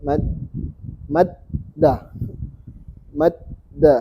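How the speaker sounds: "Madda" broken into mad, then da; "Madda" slowly split at the doubled d; tasdid demonstration. Shown as a demonstration. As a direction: stop on the d of "Madda", then release it. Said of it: "Cara bacanya kan begini".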